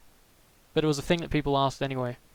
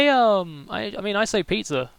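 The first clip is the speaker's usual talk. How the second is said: ordinary delivery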